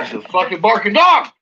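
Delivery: high, rising yell; loud exclamation climbing in pitch; exasperated outburst. Real, not acted. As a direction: burst out at the barking dog. Exclaims as dog barks once more.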